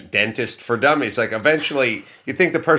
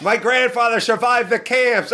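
mock boasting voice; 12 semitones higher than usual. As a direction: pipe it up in a put-on high voice. Same high voice, mock boast.

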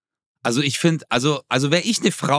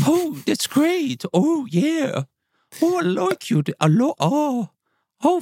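put-on much higher, slow, swooning Oxford English accent; 12 semitones higher, slower than usual. Imitating an Oxford-English-speaking partner, in a high, swooning Oxford English accent. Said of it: "Oxford Englisch".

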